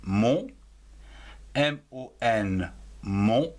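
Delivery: Mon then M O N letter by letter; spelling out. Shown as a demonstration. Teacher spelling Mon aloud to children. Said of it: "C'est un mot invariable".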